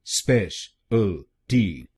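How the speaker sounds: Speciality drawn out very slowly, syllable by syllable; slow demo. Shown as a demonstration. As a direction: spell speciality out slowly for learners. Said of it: "तो बनेगा". (Speciality को बहुत धीरे धीरे, एक एक शब्दांश)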